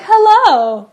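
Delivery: high, loud hello; bright loud greeting. Retold or imitated. Lurking fries owner greeting the American, loudly. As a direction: pounce with a big hello. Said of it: "be like".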